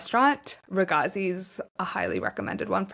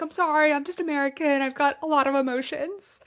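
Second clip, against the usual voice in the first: sheepish apology; 7 semitones higher than usual. Retold or imitated. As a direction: play the teary, embarrassed American, pitched up. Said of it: "I'm like".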